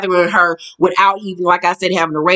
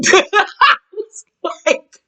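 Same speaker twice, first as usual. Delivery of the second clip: laughing; 10 semitones higher than usual. Real, not acted. Laughing while telling the fight.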